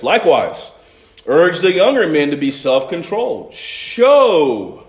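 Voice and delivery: scripture reading. Acted. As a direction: read the verses aloud with weight.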